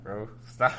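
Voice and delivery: normal talking voice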